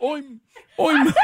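Oim in a high mock Irish sound. Cohost mocks the failed accent, hammed-up Irish Oim. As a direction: parrot her Oim with a hammed-up brogue. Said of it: "trying to do an Irish accent".

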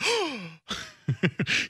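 sharp, high mock gasp; shocked gasp. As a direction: gasp as if scandalised. Acted shocked reaction to overheard swearing.

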